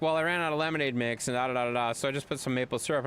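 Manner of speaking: quick, breezy run-through of an excuse; rushed excuse. Retold or imitated. Girlfriend brushing it off, hurried and light. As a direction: rattle it off fast with a careless "da, da, da".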